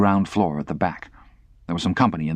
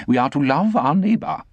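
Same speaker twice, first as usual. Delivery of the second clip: pious lady voice; 7 semitones higher than usual. Acted. Sarah Pocket speaking.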